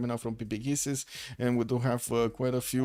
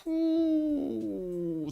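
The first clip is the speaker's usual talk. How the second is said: surprised whoo. Real, not acted. Surprised at the gold count.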